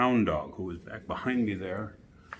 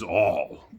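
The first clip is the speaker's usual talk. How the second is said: "all" hammered out loud; great emphasis; 5 dB louder than usual. A narrator's reading. The king insisting on "all", looking hard at Alice. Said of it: "he said with great emphasis".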